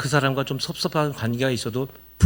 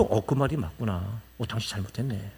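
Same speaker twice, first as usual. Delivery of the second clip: calm agreement; 6 semitones lower, 11 dB quieter than usual. Shown as a demonstration. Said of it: "분명한 논리와 분명한 해석".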